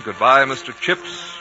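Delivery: ordinary talk, nothing marked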